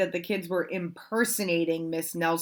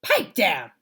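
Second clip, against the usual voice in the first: stern order; 8 dB louder than usual. Acted. Swamp orders class.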